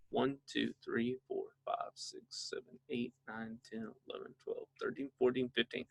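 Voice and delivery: counting under the breath, nearly voiceless; silent counting. Shown as a demonstration. Dad counting quietly to fifteen, waiting for his son, whispered. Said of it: "but count to fifteen".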